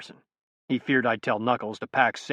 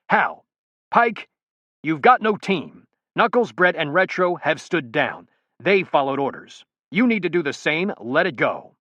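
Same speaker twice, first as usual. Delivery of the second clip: raised, louder exasperated order; 6 semitones higher, 5 dB louder, slower than usual. A narrator's reading. Colonel scolds Pike on the phone, exasperated and loud. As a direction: commander losing patience with a defiant soldier. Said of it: "Exasperated, he said".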